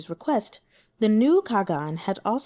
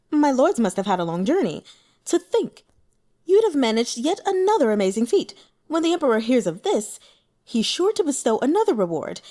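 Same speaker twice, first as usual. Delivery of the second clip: higher, louder, eager official flattering his lords; raised, louder sycophantic praise; fawning county magistrate; 5 semitones higher, 4 dB louder than usual. Acted. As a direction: gush over them like a toadying local magistrate.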